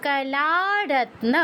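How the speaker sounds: কলারত্ন with a long drawn লা. Shown as a demonstration. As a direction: stretch the লা in কলারত্ন slowly. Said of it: "লাতে দীর্ঘ হবে".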